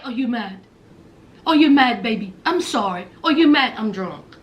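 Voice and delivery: put-on low, slurred, coaxing drunk voice; drunk Jasmine voice. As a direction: sweet-talk him, low and sloppy. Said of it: "Jasmine was like".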